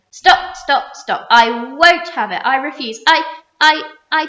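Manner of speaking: loud, frantic protest; panicked refusal. A narrator's reading. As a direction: refuse in terror. Terrified earthworm refusing.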